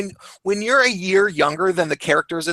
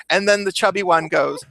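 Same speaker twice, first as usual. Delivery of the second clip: normal talking voice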